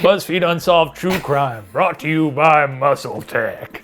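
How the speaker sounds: playful announcer voice, drawn out at the end; mock sponsor read. Host imagining Muscle Tech as sponsor, mock announcer read. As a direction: read it like a show's sponsor tag.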